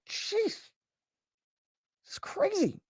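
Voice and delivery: high, soft, hushed clerk voice; high soft mock clerk. Courtroom clerk reacting to the judge, high and hushed. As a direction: squeak it out like a startled clerk. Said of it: "The clerk's like".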